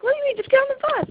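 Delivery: high, loud impatient disbelief; exasperated outburst. Retold or imitated. Camp director to boy stalling at the bus, high loud impatience. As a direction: brush him off and push him aboard.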